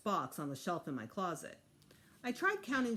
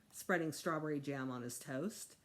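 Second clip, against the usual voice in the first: plain reading voice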